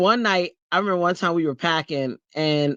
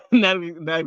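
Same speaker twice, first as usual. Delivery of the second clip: ordinary talk, nothing marked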